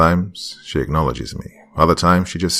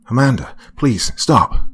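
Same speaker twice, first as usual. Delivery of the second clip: high, louder plea to stop; pleading yell; 7 semitones higher, 5 dB louder than usual. A narrator's reading. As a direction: yell after her, begging. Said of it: "but I yelled at her".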